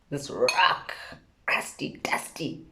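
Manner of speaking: whispered, disgusted aside; a scornful whisper about the rock. Real, not acted. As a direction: breathe the words out with no voice. Whispered words describing the planet.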